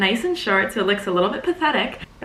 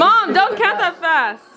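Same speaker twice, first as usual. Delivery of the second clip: laughing plea, slow; 8 semitones higher, slower than usual. Real, not acted. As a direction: plead while laughing.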